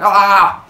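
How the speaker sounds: high loud yell of fright; loud scream of alarm. Pretend. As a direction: scream as if caught off guard. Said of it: "And you're like".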